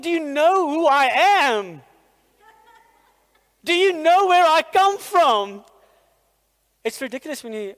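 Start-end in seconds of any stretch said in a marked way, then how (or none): 0.0-5.6 loud, high, drawn-out outraged outburst; hammed-up fury, pulling rank on the pastor; indignant, mad. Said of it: "I was mad at him".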